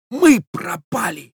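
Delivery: high, drawn-out strained stage whisper; horrified, stretched-out realisation; despairing whisper. A narrator's reading. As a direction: lament slowly in a high voice of doom. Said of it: "прошептал молодой человек".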